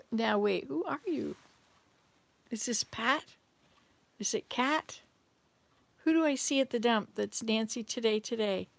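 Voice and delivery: ordinary delivery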